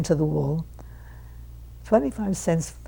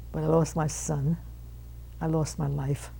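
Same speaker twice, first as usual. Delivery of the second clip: quiet grief; 2 semitones lower, 7 dB quieter than usual. Real, not acted. Speaker recalls losing her son.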